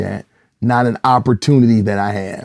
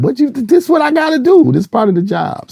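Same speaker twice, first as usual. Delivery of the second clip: high, loud defensive pleading; mock protest; 4 dB louder than usual. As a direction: plead your case with comic exasperation. Retold protest to his daughters.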